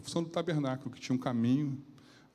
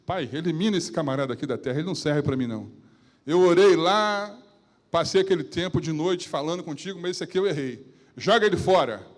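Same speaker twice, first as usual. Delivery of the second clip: desabafo alto e exasperado; irritação imaginada; 3 semitones higher, 8 dB louder than usual. Retold or imitated. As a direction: fale como quem perdeu a paciência. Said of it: "Eu fico pensando comigo". (pregador imaginando sua reação irritada, em voz alta)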